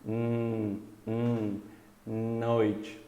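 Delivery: soft nasal hum, then noite with a long N; nasalized N. Shown as a demonstration. Teacher models the N, nasal hum leading into noite. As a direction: sustain hum through the nose and lead into noite.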